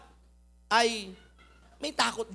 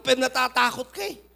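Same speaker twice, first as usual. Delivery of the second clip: ordinary talk, nothing marked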